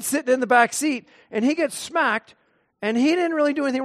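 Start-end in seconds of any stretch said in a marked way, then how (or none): none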